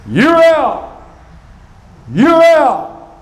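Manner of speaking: high shouted call, cut off hard; umpire screaming. Retold or imitated. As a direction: yell the call like an angry umpire. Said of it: "had his fists up near screaming".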